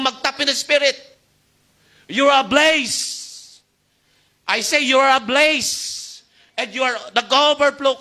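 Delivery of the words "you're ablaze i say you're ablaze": each word drawn out and declared; slow proclamation. Acted. Preacher declaring to his youth congregation, slow and emphatic. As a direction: proclaim it slowly over them, like a verdict.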